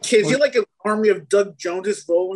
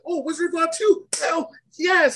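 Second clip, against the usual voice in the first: put-on excited kid; 12 semitones higher than usual. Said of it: "I was like".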